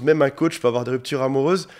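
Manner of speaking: usual speaking voice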